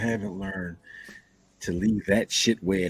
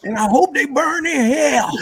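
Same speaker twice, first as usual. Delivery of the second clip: much higher and louder, a mock courtroom cry; a much higher, louder mock movie cry; hammed-up movie line; 8 dB louder than usual. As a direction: belt out the famous line in a hammed-up way. Quoting the classic movie line.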